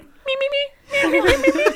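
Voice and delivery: very high, soft little bird voice; tiny eagle voice. Pretend. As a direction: peep it like a timid little eagle. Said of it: "soft little voices".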